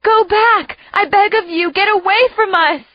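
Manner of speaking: high, loud pleading yell. A narrator's reading. Mother yelling at her children to flee. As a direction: beg them to leave. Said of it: "My mother yells out".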